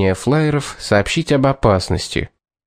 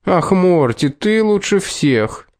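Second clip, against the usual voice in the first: higher, slow swooning voice; mocking girly coo; 8 semitones higher, slower than usual. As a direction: tease him in a sugary girl voice. Mocking Kat's crush.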